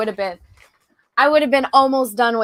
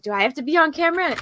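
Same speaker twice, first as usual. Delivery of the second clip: higher, quick anxious query; lightly acted reluctant question; retold worried question; 7 semitones higher, faster than usual. Guest recalls asking the host about filming, retold worried question. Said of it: "I was like".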